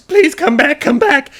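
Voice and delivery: high, loud tearful pleading; hammed-up sobbing dragon shriek; crying dragon. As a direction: wail for them to return. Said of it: "she starts to cry".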